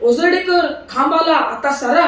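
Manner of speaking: shouting warning. A narrator's reading. Wife shouts to friend behind to dodge poles, loud. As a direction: yell a warning over the wind. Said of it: "अधूनमधून ओरडायच्या".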